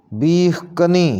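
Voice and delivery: बीह कनी with 'ka' opening the second word; correct pronunciation. Shown as a demonstration. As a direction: say बीह कनी as 'beekh ka-nee'. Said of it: "इसको सही ऐसे करके पढ़ेंगे". (बीह कनी के 'क' पर ज़बर बोलें)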